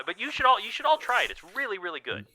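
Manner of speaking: high-pitched, keen wizard voice; friendly wizard recommending his potion; eager famous wizard. Acted. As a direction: pitch it high and urge everyone to try it.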